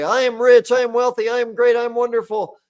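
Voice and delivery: loud, high, pumped-up self-praise; over-the-top cheerleading of yourself; forced affirmations. Retold or imitated. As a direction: chant it like a hyped-up motivational mantra. Mock affirmations, shouted self-praise.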